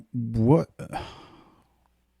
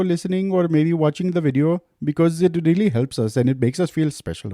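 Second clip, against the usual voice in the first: higher, lightly put-on Indian accent; 6 semitones higher, 4 dB louder than usual. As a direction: attempt an Indian accent, a bit unsure. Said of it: "my Indian accent is not too good".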